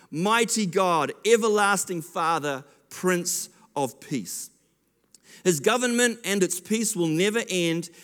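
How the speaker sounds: solemn, declamatory Bible reading; scripture reading. Acted. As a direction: proclaim the verses with conviction. Reads scripture aloud.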